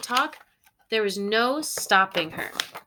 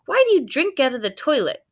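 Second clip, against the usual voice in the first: quick high question; 6 semitones higher, 5 dB louder, faster than usual. Acted. Family asks dog question.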